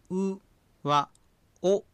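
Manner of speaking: う and お spoken with lips rounded; rounded-lip vowel demo. Shown as a demonstration. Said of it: "という時のように口を丸めて発音する". (口を丸めて「う」「お」を発音)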